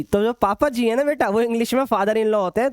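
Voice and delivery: ordinary delivery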